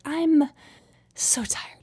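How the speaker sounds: mock-weary toddler, stopping after "I'm" before a breathy "so tired"; dramatic pause. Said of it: "pause really dramatically before he says".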